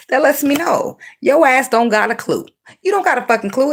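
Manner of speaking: high, heated rant; high-pitched exasperated scolding. Real, not acted. Angry rant at unreliable partner.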